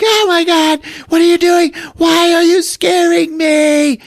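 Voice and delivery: very high, loud wail; hammed-up terrified child, stretching the last words; scared shrieking. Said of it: "scared of what's happening with the seance".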